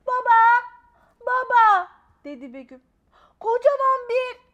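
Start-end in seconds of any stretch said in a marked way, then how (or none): 0.1-1.9 put-on very loud, high child's shout; yelling for dad. A narrator's reading. Child yells for father. 3.4-4.4 put-on high, loud urgent child voice; frantic child. A narrator's reading. Child insists in alarm.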